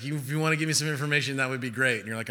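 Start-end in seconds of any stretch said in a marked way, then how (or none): none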